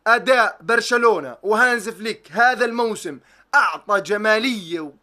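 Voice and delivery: ordinary delivery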